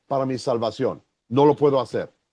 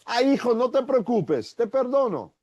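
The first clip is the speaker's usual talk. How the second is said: put-on higher, gentle forgiving tone to a child; tender forgiving father; 7 semitones higher than usual. Father forgiving his son for eating the cookie, higher and gentle. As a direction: soothe the boy, let it all go. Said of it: "Se digo".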